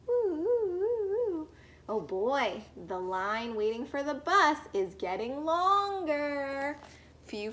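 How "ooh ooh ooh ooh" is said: high hooting siren sound; playful ooh-ooh siren call; siren imitation. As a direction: sing out a fire engine siren.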